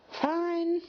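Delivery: high, pouty pony voice; sulky Pinkie. Acted. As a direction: give in with a little pout.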